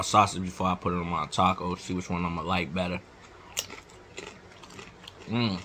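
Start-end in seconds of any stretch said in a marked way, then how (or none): 2.2-5.6 talking while chewing; crunchy snack in mouth; mouth full of chip. Real, not acted. Seen 2: Bites chip at -2.0; pursed chewing mouth, chip held to lips early.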